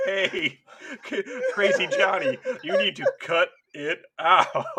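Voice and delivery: hammed-up TV dad telling him off; mock sitcom dad, laughing through it; laughing sitcom scold.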